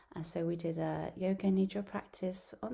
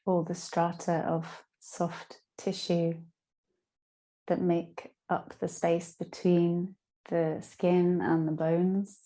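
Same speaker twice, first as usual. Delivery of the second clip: guided meditation; 10 dB louder than usual. Acted. Yoga teacher guiding listeners lying down through Yoga Nidra, guided meditation. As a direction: guide them down into rest, phrase by phrase.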